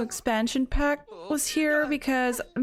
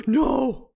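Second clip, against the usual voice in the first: sharp shouted no after a gasp; a gasp, then a loud no; shocked no; 4 dB louder than usual. Real, not acted. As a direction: blurt it out in horror. Realising she misrolled.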